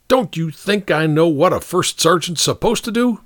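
high, irritated captain; snapping. A narrator's reading. Captain resenting advice from his first sergeant, snapping. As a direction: cut the sergeant off sharply. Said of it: "the captain snapped".

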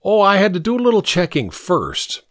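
put-on high-pitched, self-important boy; lofty. A narrator's reading. Pink explaining himself to Steinweister, lofty. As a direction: say it airily from on high. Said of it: "Pink said in a lofty manner".

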